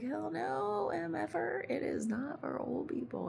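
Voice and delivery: high, emphatic hell no; indignant retort. Retold or imitated. Answering people who call aqua aerobics for old people, high emphatic retort. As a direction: fire it back at the doubters.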